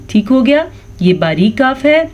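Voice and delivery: usual speaking voice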